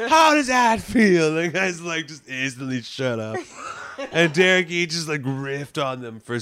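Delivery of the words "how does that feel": high, slow sarcastic drawl; mocking. Comic mocking the heckler's claim, slow high sarcasm. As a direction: sneer back their own words. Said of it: "comic's burn retold".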